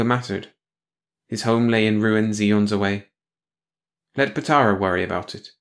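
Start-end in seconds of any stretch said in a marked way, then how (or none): none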